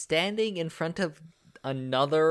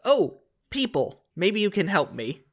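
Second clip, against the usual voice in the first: higher, surprised ghost greeting; acted surprised exclamation, raised pitch; ghost's surprise; 6 semitones higher than usual. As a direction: pitch it up, as if startled to find people. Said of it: "turns and looks around and he goes".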